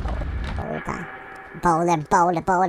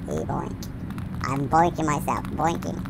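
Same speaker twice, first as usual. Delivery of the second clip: normal talking voice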